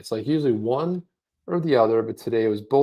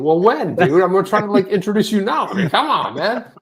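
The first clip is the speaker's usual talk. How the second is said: higher, louder, impatient pleading; exasperated; 7 semitones higher, 5 dB louder than usual. Real, not acted. Host waiting on Oscar's pronunciation, exasperated. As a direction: complain in comic exasperation.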